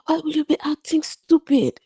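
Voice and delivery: high-pitched mock angry outburst; angry father. Father scolding daughter over the phone after the wedding, imitated anger. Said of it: "he said that in anger".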